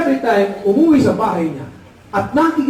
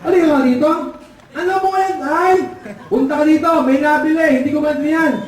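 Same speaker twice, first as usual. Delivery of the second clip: high, urgent summons; father calling out; 6 semitones higher than usual. Acted. Father calling the child to help with the customer, calling out. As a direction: call the child over, pitched high. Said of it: "tinawag yung anak ng ngongo".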